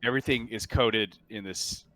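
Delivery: ordinary talk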